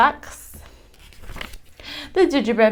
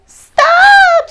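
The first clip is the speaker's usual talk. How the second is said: a much higher, much louder cry of stop; Jill yelling stop; 13 dB louder than usual. A narrator's reading. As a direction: hold the one word out in a loud, high yell. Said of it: "says Jill".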